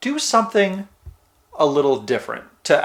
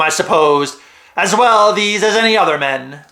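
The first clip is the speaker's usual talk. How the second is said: hammed-up pirate, swelling on key words; pirate accent drifting toward Italian; 3 semitones higher than usual. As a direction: read it like an old sea-dog pirate. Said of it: "I might do this in a pirate accent. It might drift in and out of some Italian kind of thing".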